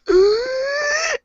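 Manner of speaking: high, sharp mock gasp; a theatrical inhale of terror; dramatic gasp. Light gasping in slow motion.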